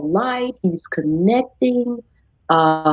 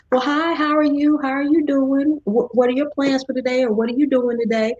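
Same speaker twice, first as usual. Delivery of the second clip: bright, higher welcoming host voice; friendly greeting; 6 semitones higher than usual. Retold or imitated. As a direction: greet a neighbor at the door. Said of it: "the first thing I say is".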